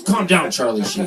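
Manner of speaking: loud comic rebuke; retold yell to settle down; exasperated shout. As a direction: cut off a rant, loudly. Doctor cuts off his rant.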